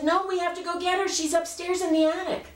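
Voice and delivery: put-on very high, protesting little-girl voice; insistent child. Child refusing her mother's "that's enough", insistent.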